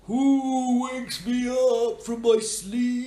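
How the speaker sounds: high, slow, loud sleepy tree; a grumpy tree spirit just woken up. Acted. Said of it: "He goes".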